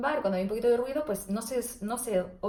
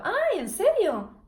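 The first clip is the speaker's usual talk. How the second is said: higher, louder slow "¡Ay, en serio!" in head voice; head voice exclamation; 9 semitones higher, 5 dB louder, much slower than usual. Shown as a demonstration. Therapist demos head voice in everyday speech. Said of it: "Esto es voz de cabeza".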